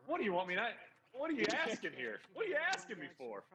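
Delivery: high, incredulous, exasperated rant. Retold or imitated. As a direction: act baffled and put upon by the request. Imagined exasperated headline writer.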